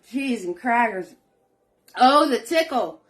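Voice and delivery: real choked-up outburst after a bad swallow; choking on coffee. Real, not acted. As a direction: talk through a coughing fit. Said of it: "Coffee just went down the wrong pipe".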